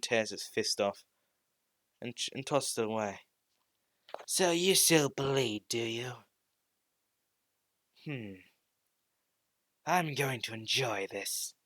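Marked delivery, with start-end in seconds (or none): 4.3-11.5 sinister possessed voice. Acted. Possessed Deku savours the fight.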